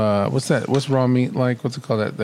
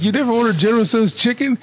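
surprised question; 9 semitones higher, 3 dB louder than usual. Retold or imitated. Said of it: "I was like".

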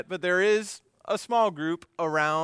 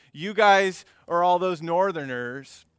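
loud, higher, drawn-out accusing; loud accusation; 4 semitones higher, 7 dB louder than usual. Acted. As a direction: call out the whole group slowly. Crowd accusation resumed.

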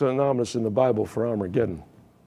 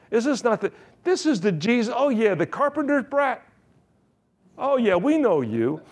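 high, scornful crowd voice, pitch climbing to a jeer; mock him as the carpenter's brat; sneering townsfolk; 6 semitones higher than usual. The synagogue crowd sneers at Jesus, high and scornful.

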